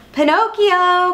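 high, drawn-out call. A narrator's reading. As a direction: call out after a wandering child. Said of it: "called Jiminy Cricket".

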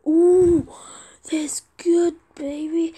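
loud, playful put-on exclamation; silly put-on voice. Player reacts to a trade offer, silly put-on voice. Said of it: "I don't know why I'm talking like this".

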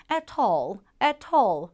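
"at all" in a British accent with a crisp T; "at all", British, T kept clear. Shown as a demonstration. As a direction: say "at all" the British way, T fully sounded. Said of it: "영국식으로는".

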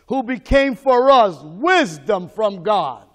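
slow, loud, drawn-out pulpit proclamation; full-force preaching. Acted. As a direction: hammer each phrase out slowly like a revival preacher.

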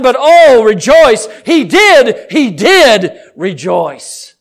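ringing sermon appeal, hard on key words; full-force preaching. Real, not acted. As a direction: preach it urgently to the congregation.

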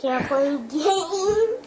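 loud slow whine; crying. Real, not acted. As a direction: wail it out slowly. Child upset game won't play.

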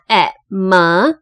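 separated e, m, e, me; sounding out short e. Shown as a demonstration. Teacher showing the first syllable, sounding out me. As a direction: blend m and short e into me as in medicine. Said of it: "第一个音节的E是念成e".

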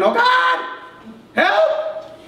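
high-pitched panicked cry for help; mock scream. Preacher acts out believers at the precipice, mock screaming. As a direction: yelp it out, leaping high on "help". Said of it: "start screaming".

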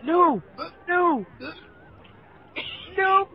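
high, loud wail of NO; dismayed shout. Real, not acted. Losing in the race.

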